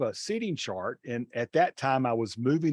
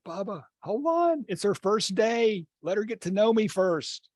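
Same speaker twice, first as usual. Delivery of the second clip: high, urgent call to hold back; calling out to stop him; 9 semitones higher than usual. Retold or imitated. Teacher stopping a student about to fight, urgent high call. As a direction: rein him in fast, raised voice.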